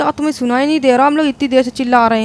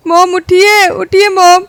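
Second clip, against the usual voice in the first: much higher, louder anguished cries; wailing grief; 5 semitones higher, 6 dB louder, slower than usual. Acted. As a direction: beg mom to wake, sobbing. Son wailing over dead mother. (माँ को उठाते हुए रोते-बिलखते)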